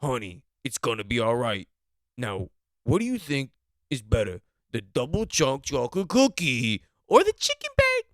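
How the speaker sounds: building to a loud sales-pitch yell; hyped-up husband. Retold or imitated. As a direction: sell the cookie like your life depends on it. Husband pushing his Costco pitch.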